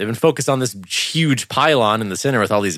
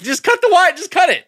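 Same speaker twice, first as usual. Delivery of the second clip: high, pleading shout at the screen; hammed-up high plea to cut it; mock urgent yell. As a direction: beg the characters to cut the wire. Retold yelling at the screen.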